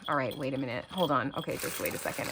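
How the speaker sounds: low, soft near-whisper; hushed self-calming. Real, not acted. Vlogger to herself after a spider, whispering. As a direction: whisper yourself calm.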